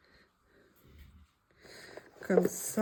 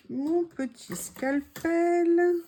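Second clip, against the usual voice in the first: tender; 7 semitones higher, 3 dB louder, much slower than usual. Real, not acted. Fond address to her tool.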